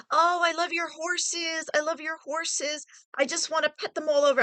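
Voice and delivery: high, gushing, then rushing the last line; gushing over horses. Retold or imitated. Girl gushing to her prom date about his horses. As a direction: play up your own giddy delight at the horses. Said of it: "So if I was like".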